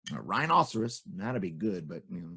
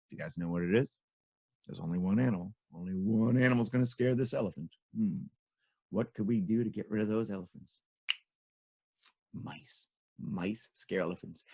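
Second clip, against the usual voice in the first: musing softly; 5 semitones lower, 7 dB quieter than usual. Acted. Storyteller muses softly.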